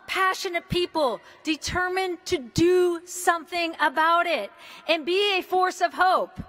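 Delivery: ordinary talk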